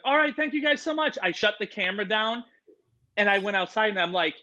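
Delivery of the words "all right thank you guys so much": high, strained cheery thank-you; forced polite sign-off. Retold or imitated. Signing off the chaotic Zoom, in a strained high cheer. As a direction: fake a cheerful goodbye while panicking. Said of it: "I was like".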